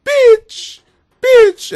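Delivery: high loud yelping puppy voice. Retold or imitated. The puppy barking at the woman, high yelping. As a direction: yelp each word like a dog barking. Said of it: "the puppy's going".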